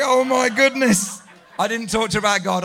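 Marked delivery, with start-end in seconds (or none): 0.0-1.2 slow, drawn-out dismay; a slow embarrassed oh my goodness; mortified gasp. Retold or imitated. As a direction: sound caught out and horrified. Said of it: "And I'm like".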